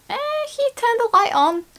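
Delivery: high, indignant "Eh, he turned the light on"; defensive retort. Acted. Teacher voicing a defensive reply, indignant. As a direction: push back that he did do something. Retorting that Ted did help.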